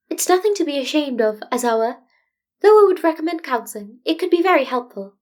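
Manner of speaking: a careful, tactful principal; diplomatic Nezu. Acted. Nezu's voice held by narrator.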